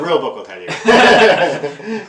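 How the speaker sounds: laughing through the words. Real, not acted. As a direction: chuckle while saying it. Joking, laughs while speaking.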